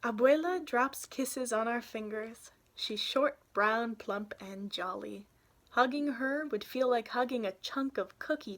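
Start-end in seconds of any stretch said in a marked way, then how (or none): none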